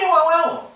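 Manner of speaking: Nwanwanwu rattled off high and loud as "wah-wah"; mispronounced name. Retold or imitated. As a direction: mimic the botched name Nwanwanwu, quick and high. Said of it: "I have to correct them".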